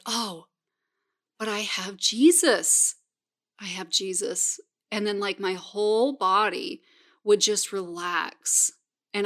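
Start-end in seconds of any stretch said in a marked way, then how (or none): 0.1-4.6 calm relief. Retold or imitated. Scared child comforting herself.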